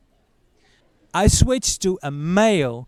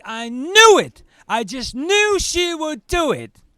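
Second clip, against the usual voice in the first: triumphant I knew it; 10 semitones higher, 6 dB louder than usual. Acted. Vindicated thought.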